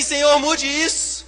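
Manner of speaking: high, loud, pleading mock prayer; mock begging prayer. Pastor imitating a believer begging God, high and loud. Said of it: "E você assim".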